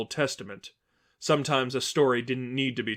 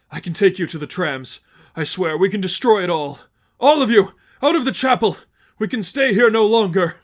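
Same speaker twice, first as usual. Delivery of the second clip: high, loud order to clear out; Lester, commanding; 7 semitones higher, 9 dB louder than usual. Acted. Lester ordering his followers out of the chapel, loud. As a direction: send everyone out of the chapel.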